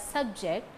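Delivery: SUBject as a noun, weight on SUB; stress on first syllable. Shown as a demonstration. Teacher demonstrates the noun form, SUBject stressed first. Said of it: "it is noun".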